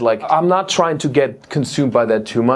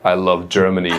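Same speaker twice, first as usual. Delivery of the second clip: overzealous Germany fan; 7 semitones lower than usual. Acted. Man at the show confessing his love to the comic, low and creepy. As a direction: lean in low, far too devoted to Germany. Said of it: "one dude who loves Germany a little bit too much".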